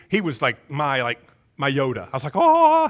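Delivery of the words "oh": high, loud drawn-out "oh"; awestruck gush. Retold or imitated. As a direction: gush like a starstruck student. Awestruck student looking up to mentor.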